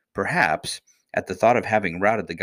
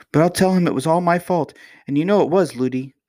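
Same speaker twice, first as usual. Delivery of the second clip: put-on high, louder child voice; emphatic young princess, pitched up; little princess, insistent; 9 semitones higher, 5 dB louder than usual. A narrator's reading. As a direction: insist like an earnest little girl.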